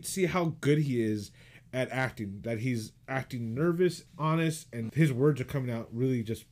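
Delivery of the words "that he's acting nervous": ordinary talk, nothing marked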